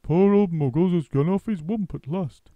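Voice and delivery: a fond joke about his friend; loud chuckling bird; roly-poly bird. A narrator's reading. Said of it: "The roly-poly bird said".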